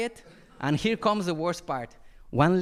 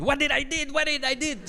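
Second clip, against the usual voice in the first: mock panic; 8 semitones higher than usual. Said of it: "imagining what mental state".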